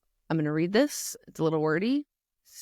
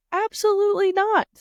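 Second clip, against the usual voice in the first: much higher, louder, absolutely not; emphatic denial; 14 semitones higher, 4 dB louder than usual. Real, not acted. To moms, answering whether nuggets are a sin, a loud high denial. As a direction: slam the idea down hard. Answering her own rhetorical question.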